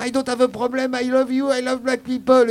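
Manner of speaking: panicked pleading. Retold or imitated. Scared friend pleads with three men.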